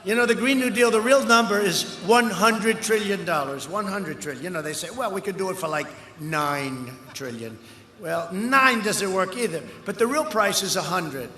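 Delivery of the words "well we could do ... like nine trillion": dragged-out sneering imitation; mocking quote. Mocking the opponents' claim.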